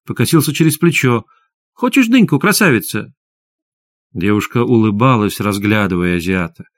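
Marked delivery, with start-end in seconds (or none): 1.7-3.1 much higher opening, dropping at the end; wheedling little man. A narrator's reading. Little man offers melon to a girl, wheedling. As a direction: coax her in a high character voice.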